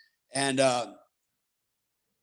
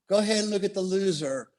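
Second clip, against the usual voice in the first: bitter sarcasm; 6 semitones higher than usual. Retold or imitated. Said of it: "I remember thinking to myself".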